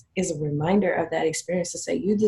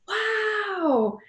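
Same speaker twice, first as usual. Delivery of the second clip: high, drawn-out amazed wow; awestruck; 13 semitones higher, 4 dB louder, much slower than usual. Retold or imitated. Client seeing their edited photos, awestruck. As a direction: imitate a client stunned by their own photos.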